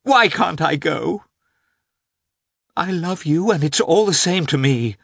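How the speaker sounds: high, loud, impassioned woman's voice; a wife defending her choice, loud and high; passionate Anna. A narrator's reading. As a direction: pour it out in defiant passion. Anna's passionate outburst.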